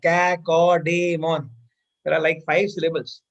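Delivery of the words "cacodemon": drawn out slowly. Shown as a demonstration. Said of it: "नाम बोलने में कितना अजीब लग रहा है".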